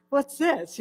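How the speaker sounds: baffled at being unhurt; a bright puzzled what's this; surprised. Retold or imitated. Unhurt after jumping off roof.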